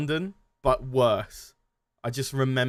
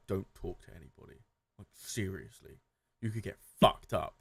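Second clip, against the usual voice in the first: low, soft, hurried warning; quiet warning; 5 semitones lower, 6 dB quieter than usual. Retold or imitated. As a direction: hush it like a serious tip-off. Friend warning about city dangers.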